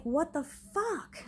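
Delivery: slow, high and stunned; shocked disbelief. Retold or imitated. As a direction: drag it out in disbelief. Startled in the shower by pounding.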